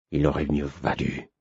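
low, hollow, scornful master; cavernous voice. Acted. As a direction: growl it from deep in the chest. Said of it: "de sa voix caverneuse".

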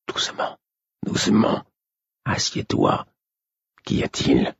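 soufflé, sans voix; chuchoté. A narrator's reading. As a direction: Imbre chuchote de peur d'être entendu. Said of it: "me fit signe de parler bas". (chuchotement prudent)

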